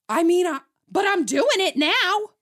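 high, loud imitation of a protesting partner; exaggerated defensive partner voice; mock defensive protest.

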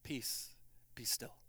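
soft, low, hushed command; quiet the storm gently; calm command. Acted. Jesus speaking to the storm and disciples, calmly hushed.

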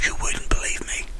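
hushed whisper. Retold or imitated. Barman confiding about the lost items, whispered. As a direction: confide it secretly.

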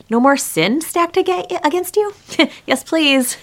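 high, slow, laughing relief; mock delight. Pastor voices the forgiven one, high and laughing. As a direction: relish it like a debt wiped clean.

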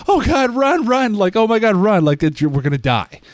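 mock panic, high-pitched and breathless rush; hammed-up terrified yelp, words tumbling out; frantic. Player urging the crowd to flee, acted high panic. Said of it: "this big frantic, like, event".

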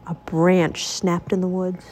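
ordinary delivery, nothing marked

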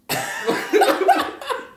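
high, breathy burst of laughter; helpless high cackle. Real, not acted. Laugh burst after story twist.

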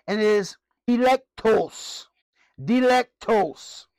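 dialectos said slowly, syllable by syllable; Greek word. Shown as a demonstration. Teacher sounding out the Greek word dialectos slowly. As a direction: spell out dialectos slowly for the class. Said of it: "in the Greek".

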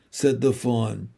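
ordinary delivery, nothing marked

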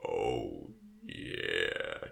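very deep, drawn-out voice; put-on voice. Shown as a demonstration. Host shows off a radio voice, put-on deep voice. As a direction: drop right down low and soft. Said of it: "I have to put on a voice".

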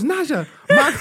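put-on panicked mother wailing over her child; frantic mother. Friend imitates the mother wailing over her fallen daughter. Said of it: "She goes".

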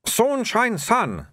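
high-pitched foreign shopkeeper voice; slow, high correction from a little trader; fussy little shopkeeper. A narrator's reading. Shopkeeper corrects his name to a customer, high accented voice.

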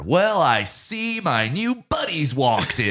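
high, loud, drawn-out fake American accent; hammed-up American accent, theatrical diction; mock Glen Powell American accent. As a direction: overdo an insistent American accent, slowly. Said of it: "sounds like a British person doing an American accent".

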